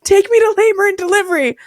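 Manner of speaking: high, urgent demand. Retold or imitated. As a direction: insist like it's an emergency. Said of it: "And I'm like".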